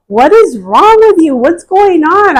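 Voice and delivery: high, urgent imitation of alarmed daughter; freaked-out child. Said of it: "she freaked out".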